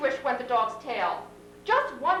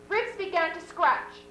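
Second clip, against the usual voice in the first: ordinary delivery, nothing marked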